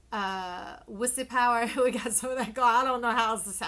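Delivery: loud laughing blurt. Real, not acted. Reader cracking up at her own cheeky word, loud laugh. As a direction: burst out laughing at your own joke.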